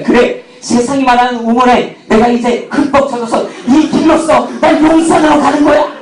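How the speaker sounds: high-pitched, building mock-heroic declaration; hammed-up triumph. Preacher replaying his triumph at driver selection, mock triumphant. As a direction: crow it like you have already won.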